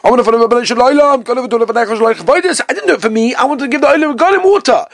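hammed-up earnest prayer; pleading prayer. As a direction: cry out the prayer like Nakdimon pleading for rain. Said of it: "he davened".

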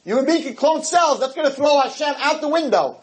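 high, mocking voice of the sceptics; mock the clone-cell crowd in a lofty high voice; mocking quote. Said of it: "So some people".

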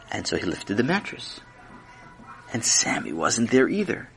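usual speaking voice

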